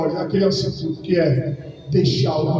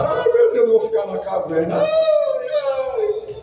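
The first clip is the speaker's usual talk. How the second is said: a higher, hammed-up childish whine that squeals up on the last "ai"; mock whimpering. The believer who acts like a child whines about hiding in a cave, hammed-up. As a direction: whimper like a spoiled child running off to hide.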